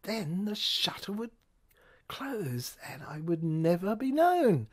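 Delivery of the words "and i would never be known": normal talking voice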